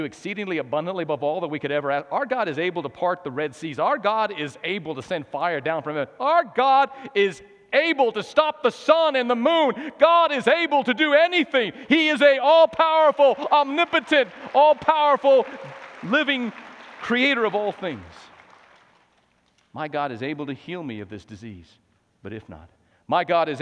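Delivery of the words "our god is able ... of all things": loud, high-pitched proclamation; thundering pulpit declaration; full-force preaching. Real, not acted. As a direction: preach it with all your might, easing at the end.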